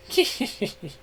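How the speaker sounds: high, soft evil chuckle; wicked chuckle. Acted. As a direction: gloat with a sly laugh. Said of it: "gave a wicked chuckle".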